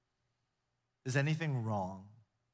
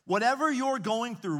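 WHATEVER called out high and loud; ringing call on WHATEVER; emphatic appeal; 8 semitones higher, 6 dB louder than usual. Acted. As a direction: lift WHATEVER and land it falling.

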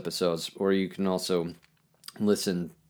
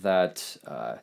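usual speaking voice

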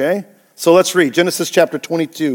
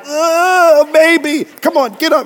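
higher pleading parent voice, coaxing; 9 semitones higher than usual. Retold or imitated. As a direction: nudge the kid to get up, pleading. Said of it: "Parents are like".

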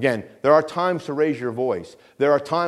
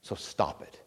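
a breathy hushed "so stop it"; whispered rebuke; 6 semitones lower, 12 dB quieter than usual. Real, not acted. Preacher pleading with his church, whispered. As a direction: whisper it as a firm plea.

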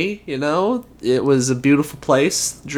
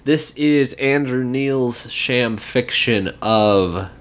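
normal talking voice

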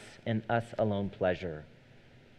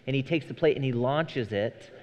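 ordinary delivery

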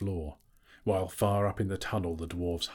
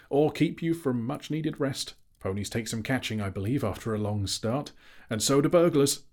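high, louder parting taunt; cheeky Bilbo; 6 semitones higher, 4 dB louder than usual. A narrator's reading. Bilbo throws a parting shot at Smaug, high and cheeky. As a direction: toss it off pertly, lifting the last phrase.

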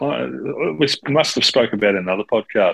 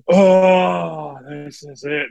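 sudden realization; 6 semitones higher, 4 dB louder, much slower than usual. Retold or imitated. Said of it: "and I was like".

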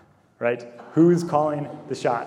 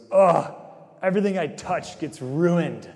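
a loud groan, then a higher, mildly exasperated whine; lightly voiced Charlie Brown, giving up in exasperation; 5 semitones higher, 4 dB louder than usual. Charlie Brown after the tree falls over, exasperated groan. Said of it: "in his exasperation".

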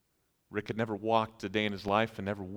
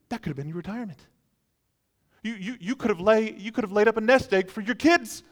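high, stammering protest that gets louder; aghast reproach; 9 semitones higher, 5 dB louder, faster than usual. Acted. Friend protesting burned life savings, aghast and building. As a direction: splutter in rising disbelief.

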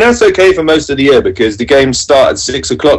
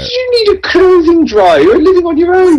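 much higher, whiny mock outrage, near tears; mock upset. Scolding his friend about owning a dryer, in mock tearful outrage. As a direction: wail it like fake despair over a dryer. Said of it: "Why are you getting so upset?".

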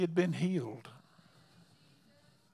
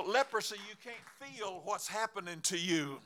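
ordinary talk, nothing marked

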